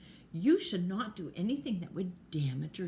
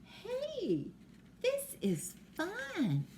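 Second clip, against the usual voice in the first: put-on much higher, slow delighted child voice; happy child; 12 semitones higher, much slower than usual. Two friends playing again, high delighted exclaim. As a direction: sing it out like a kid having fun. Girls happily playing together.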